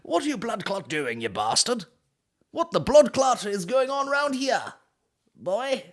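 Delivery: high, hammed-up West Indian accent. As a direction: put on an indignant, drawn-out West Indian accent. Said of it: "draw on your sort of West Indian roots".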